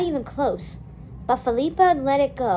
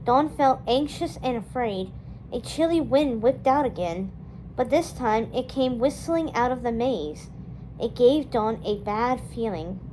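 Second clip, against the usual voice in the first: usual speaking voice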